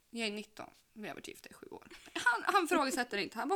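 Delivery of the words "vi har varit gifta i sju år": repeated as a breathy whisper. Acted. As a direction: drop to a secret whisper. Repeats the line for effect. (viskande, som en hemlighet)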